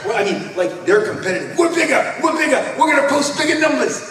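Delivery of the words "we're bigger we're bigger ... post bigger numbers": high, loud mock bragging; boastful shout. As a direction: crow it like a gloating rival. Rivals boasting again.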